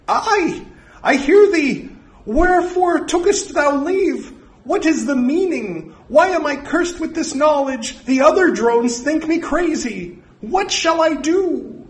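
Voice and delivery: high, loud, desperate pleading; frantic high-pitched devotee; fanatical drone. Acted. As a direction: beg the voice for answers, high and urgent.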